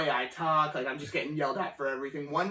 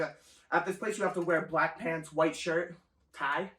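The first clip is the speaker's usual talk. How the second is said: ordinary talk, nothing marked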